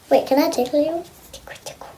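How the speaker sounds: soft unvoiced whisper. Real, not acted. Sneaking a tickle on the doll, whispered. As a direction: breathe it playfully, no voice.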